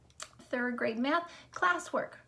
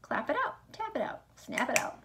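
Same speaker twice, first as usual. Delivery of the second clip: ordinary talk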